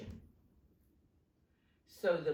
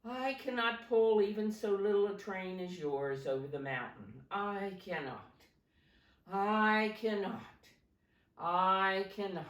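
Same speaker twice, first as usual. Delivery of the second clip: put-on tired old engine; 3 semitones higher, 5 dB louder than usual.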